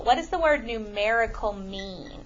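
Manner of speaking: ordinary talk, nothing marked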